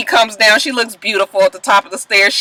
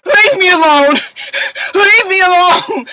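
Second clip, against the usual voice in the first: much higher, hammed-up tearful wail; mock sobbing; 9 semitones higher than usual. Imitating Amina sobbing at Peter, mock wail. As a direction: whine it like a crybaby. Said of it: "goes back into place".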